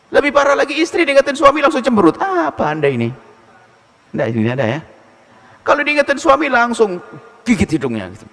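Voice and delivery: normal talking voice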